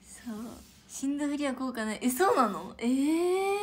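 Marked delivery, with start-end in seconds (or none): 2.8-3.6 surprised. Real, not acted. Surprised at death-feign fact.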